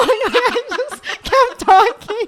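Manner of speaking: high, laughing through the words. Real, not acted. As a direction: laugh as you say it. Laughing at own fart story.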